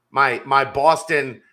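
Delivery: a light mock Boston accent; half-hearted Boston accent. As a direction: joke Southie "car to the yard". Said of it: "my Boston accent isn't as strong".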